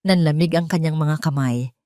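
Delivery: plain reading voice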